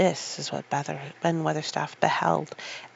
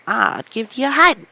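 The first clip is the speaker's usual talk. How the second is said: put-on loud old gardener shouting; furious old man yelling over the wall; angry cry; 5 semitones higher, 12 dB louder than usual. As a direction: bellow it at the girl. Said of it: "he cried".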